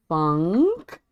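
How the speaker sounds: "Funk" said deliberately, rising at the end; careful pronunciation. Shown as a demonstration. Showing the word they have trouble saying, careful. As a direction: sound out Funk with a clear N. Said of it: "I have trouble saying that".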